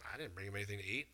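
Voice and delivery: low, soft mumbled denial; [name] mutters low and soft; sheepish denial. Retold or imitated. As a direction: mumble it like a disciple with nothing to offer. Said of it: "Peter's like".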